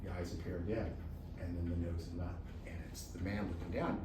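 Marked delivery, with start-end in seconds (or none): none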